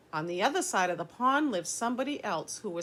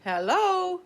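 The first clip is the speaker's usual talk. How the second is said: high, loud hello, much higher and louder; calling out; 11 semitones higher, 7 dB louder than usual. Acted. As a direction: call across the pond. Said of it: "said the voice from across the pond".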